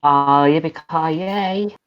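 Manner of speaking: high, slow cowboy country whoop; loud-ish country cowboy drawl; country accent. Acted. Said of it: "You turn to English into a country".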